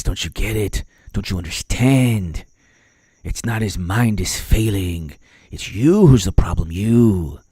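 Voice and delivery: low, slow, hushed mock-president voice; Biden impression. Host defends the president by mimicking him, low slow impression. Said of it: "Biden speaks like this".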